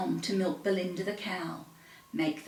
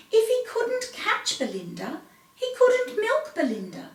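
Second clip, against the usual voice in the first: much higher anxious narration; worried; 11 semitones higher than usual. A narrator's reading. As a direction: climb in pitch with worry. Rising worry.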